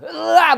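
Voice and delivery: high, very loud mock wail; wild exorcism scream. Shown as a demonstration. Shaman mocking showy exorcisms, wild scream. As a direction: howl it out, hammed-up. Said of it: "앙스럽게 막".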